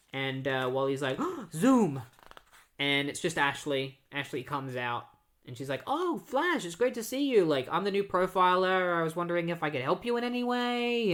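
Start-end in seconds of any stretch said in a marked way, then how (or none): 1.4-2.0 louder, higher startled exclamation; mock alarm. Wally alarmed as villain's door opens. 5.9-11.1 high, gushing impression of a newcomer; eager greeting. Ashley greets Flash eagerly.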